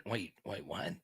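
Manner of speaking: normal talking voice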